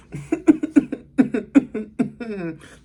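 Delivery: put-on high whiny baby fuss; babyish whine. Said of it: "she'll go".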